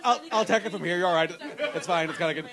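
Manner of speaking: usual speaking voice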